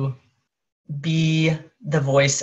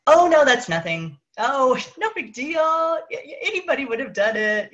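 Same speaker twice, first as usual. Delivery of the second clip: brushing off a compliment; 10 semitones higher than usual. Retold or imitated. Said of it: "we've got a".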